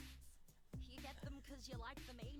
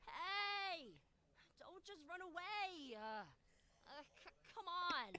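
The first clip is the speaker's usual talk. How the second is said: loud, raised-pitch protest; calling after someone; 3 semitones higher, 5 dB louder, slower than usual. Acted. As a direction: yell after a partner who's running off. Calling after fleeing giggling partner.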